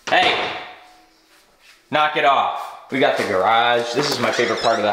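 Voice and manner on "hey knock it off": scolding. Real, not acted. Scolding the dogs.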